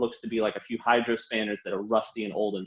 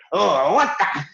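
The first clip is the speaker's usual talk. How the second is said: startled; 6 semitones higher, 8 dB louder, slower than usual. Acted. Startled shopkeeper heard offstage.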